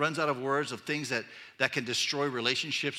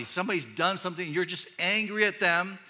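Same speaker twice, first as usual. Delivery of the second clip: ordinary talk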